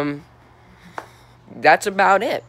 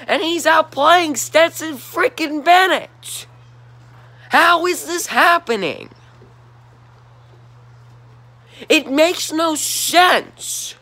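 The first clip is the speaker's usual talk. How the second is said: high, slow, exasperated rant; fed-up fan venting at his team; really mad; 12 semitones higher, 3 dB louder, slower than usual. Real, not acted. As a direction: drag out the outrage like you can't believe it. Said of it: "I'm really mad right now".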